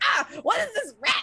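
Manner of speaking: loud, high yelp of alarm; startled man yelling at a rat; startled shout. Acted. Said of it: "He's like".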